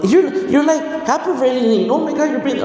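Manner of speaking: high-pitched, flustered daughter imitation; daughter tripping out. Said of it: "she was tripping out".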